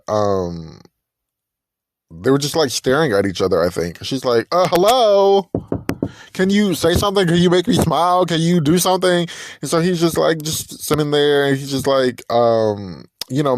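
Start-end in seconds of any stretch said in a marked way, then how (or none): 4.5-9.3 impatient prodding. Retold or imitated. Date prodding silent guy.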